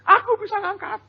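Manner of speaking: put-on high, proud little kid's voice; boasting child. Child boasting to others, high proud voice. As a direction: brag like a small child. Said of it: "Dia cerita sama orang".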